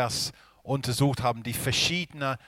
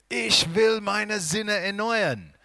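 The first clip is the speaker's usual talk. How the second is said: eager believer voice; 9 semitones higher, 4 dB louder, slower than usual. Pretend. Voicing self-striving believer.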